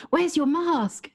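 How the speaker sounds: high, slow, pointed question; demanding shop woman. Retold or imitated. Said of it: "came running over and said".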